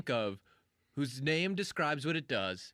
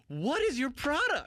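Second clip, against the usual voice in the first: high, exasperated outburst; pitched-up impatient question; mock exasperation; 10 semitones higher than usual. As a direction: demand it as if fed up with waiting.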